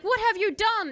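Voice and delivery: high, loud shock; horrified cry. Acted. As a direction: shout it in disbelief. Sultan sees king turned stone.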